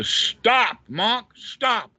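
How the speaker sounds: high, loud, commanding call to halt; Angulimala calling out. Acted. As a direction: cry out sharply to stop someone. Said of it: "where the Angulimala says to the Buddha".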